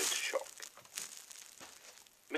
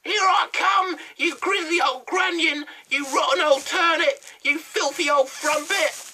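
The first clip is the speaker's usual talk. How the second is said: much higher, louder furious witch yell; yelling hag; 16 dB louder than usual. A narrator's reading. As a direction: bellow insults from the sky like a wild hag. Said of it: "called out at the top of her voice".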